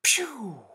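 high, loud pretend gunshot; explosive "pew" of a child at play; child's gun noise. A narrator's reading. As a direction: fire a toy gun with your mouth. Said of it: "A child's voice ... made the sound".

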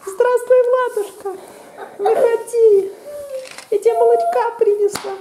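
very high sugary fake mother voice; wolf faking sweetness. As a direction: lure the piglet out sweetly. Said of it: "А это я, злобный волк. Я притворился". (волк притворяется мамой)

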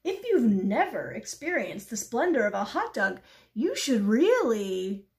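rhapsodise, stretching out the key words; rapturous pigeon. Acted. Pigeon extolling hot dogs to the duck, rapturous.

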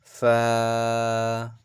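ফা with light airy f and long "aa". Shown as a demonstration. Teacher models for students, ফা light and drawn out. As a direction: let air out through the lips, hold ফা. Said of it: "একদম হালকা করে".

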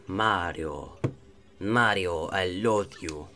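low, put-on villain voice. Villain broods over Mario, low put-on voice. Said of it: "I don't know what accent to give him now".

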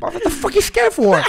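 very high, laughing; laughing disbelief. Retold or imitated. Telling friend, high laughing disbelief.

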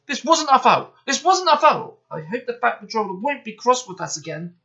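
high, loud pleading, easing at the end; worried little-engine wail; anxious protest. A narrator's reading. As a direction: protest innocence, then fret. Bill dreads being blamed.

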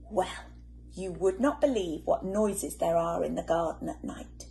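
ordinary delivery, nothing marked

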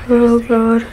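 ordinary talk

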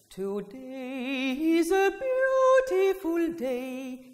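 drawn-out, slowed lines; dreamy slow delivery of a lovely day; slow demo phrase. Shown as a demonstration. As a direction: stretch the words slowly to open the rib cage. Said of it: "You get the emotion of a beautiful day".

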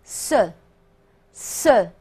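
short rounded schwa in ce, spaced out; French ce. Shown as a demonstration. Teacher demonstrates how French reads this word, careful rounded ce. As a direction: say ce with rounded lips, slowly, twice. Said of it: "法语当中读作".

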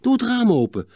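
high urgent order. Acted. Boy to brother to save a sparrow, urgent. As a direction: urge someone to hurry.

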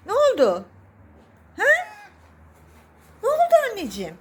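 high, swooping questions to a pet; loud, high-pitched fussing over a cat; pet talk. Real, not acted.

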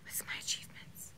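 hushed stage whisper. Real, not acted. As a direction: prompt him in a whisper. Whispered prompt during eulogy.

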